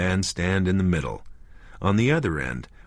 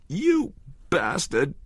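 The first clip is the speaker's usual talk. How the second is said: crying; 9 semitones higher, slower than usual. A narrator's reading. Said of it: "she said, crying".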